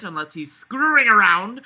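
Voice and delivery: usual speaking voice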